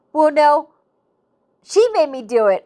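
playful imitation of blame-shifting; mock protest. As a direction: protest as if it's someone else's fault. Acting out passing the blame on.

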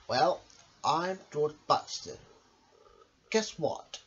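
usual speaking voice

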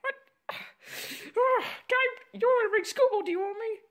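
high-pitched, stammering character voice; flustered high character. Acted. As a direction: stumble through it in a high voice.

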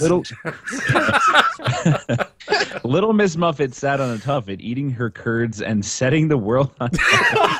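giggling through a rhyme; laughing. Pretend. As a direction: read it while cracking up. Reads nursery rhyme card.

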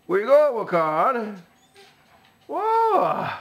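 surprised call. Real, not acted. Owner calling after her dog as he runs off, then a high whoa.

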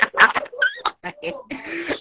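squealing high laugh. Real, not acted. Streamer cracking up on live, high squeal.

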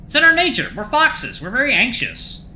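high-pitched fox voice; anxious fox character. Acted. Fox explaining their nature, high character voice.

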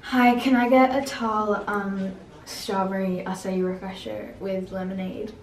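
slow, careful order in an American accent. Shown as a demonstration. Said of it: "order in an American accent".

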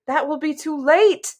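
high, anxious protest; urgent cry. A narrator's reading. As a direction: cry out in alarm, lightly acted. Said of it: "Cimmerian cried".

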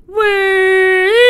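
пронзительный испуганный вскрик высоко; startled high shriek. Real, not acted. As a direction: yelp high and loud in fright. Startled by monster.

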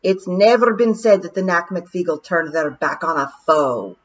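yelled. Acted. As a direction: declare the clan's honour. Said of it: "Rob yelled".

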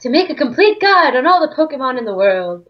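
put-on Southern belle accent. Professor explains the guide as a hammed-up Southern belle. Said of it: "Why is he now a Southern Belle?".

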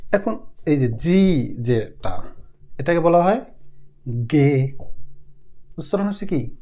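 ordinary talk

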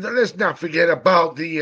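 normal talking voice

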